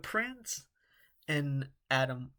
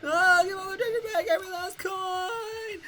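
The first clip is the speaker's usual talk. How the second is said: pleading high voice; 7 dB louder than usual. Acted. Pleading character voice in game.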